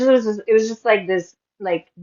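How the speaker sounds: ordinary talk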